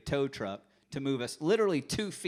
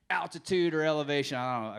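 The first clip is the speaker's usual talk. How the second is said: mocking the teacher; 5 semitones higher, 6 dB louder than usual. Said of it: "And it's like".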